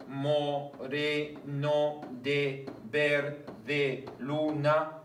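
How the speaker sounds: very slow, syllable by syllable; teacher scanning a verse aloud; slow scansion reading. Shown as a demonstration. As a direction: drag out "moreno de verde" to count it. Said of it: "uno, dos".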